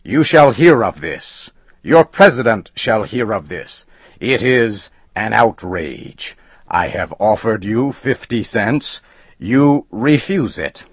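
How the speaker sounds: high, loud threatening outburst; angry threat. A narrator's reading. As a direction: threaten him with the law. Customer threatens the agent.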